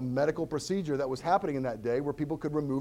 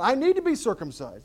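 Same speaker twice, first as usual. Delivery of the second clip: higher, louder mock pleading; mock voice of the church; 9 semitones higher, 5 dB louder than usual. Pastor mimicking early church voices, mock pleading.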